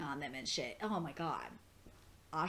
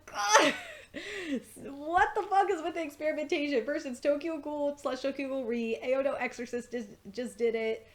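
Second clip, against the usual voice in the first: loud, high cry of exasperation; exasperated outburst; 7 semitones higher, 6 dB louder than usual. Retold or imitated. Fan ranting about experimentation in manga lately, exasperated outburst. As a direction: burst out loud, then keep ranting high.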